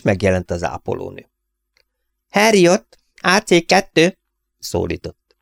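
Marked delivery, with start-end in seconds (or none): 2.3-4.2 nurse calling the next patient, high and louder; high, loud nurse's call. A narrator's reading. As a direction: call the name across the waiting room. Nurse calls him in.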